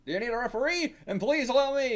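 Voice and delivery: high, loud character voice; eager volunteer. Acted. Offering to step in as referee, eager and loud.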